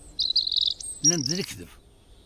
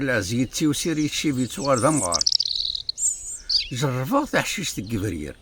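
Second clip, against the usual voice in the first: measured, projected recital of poetry; poem recitation; 5 dB louder than usual. Acted. Reciting a poet's verses before explaining their words, declaimed. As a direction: declaim the verses, mostly louder than conversation.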